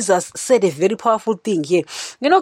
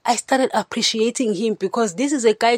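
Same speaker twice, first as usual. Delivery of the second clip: ordinary talk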